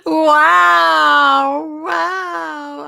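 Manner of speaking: loud delighted gasp of wow; surprised wow. Real, not acted. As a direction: react amazed and thrilled. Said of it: "what an introduction".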